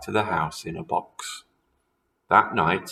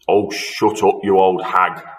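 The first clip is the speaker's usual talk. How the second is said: put-on louder gruff old husband; husband snapping at wife; 6 dB louder than usual. A narrator's reading. Husband telling wife to shut up, louder gruff snap. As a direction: bark it at the old woman. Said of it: "Mister Twit said".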